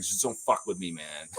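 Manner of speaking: quoting a point guard calmly warning his coach; matter-of-fact. Retold or imitated. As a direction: say it plainly with no heat, dropping away. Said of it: "Matter of fact".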